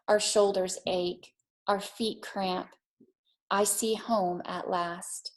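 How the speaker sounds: put-on gentle read-aloud for children; calm storytelling pace for a classroom; storybook reading. A narrator's reading. Teacher reads picture book aloud.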